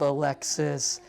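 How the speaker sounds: normal talking voice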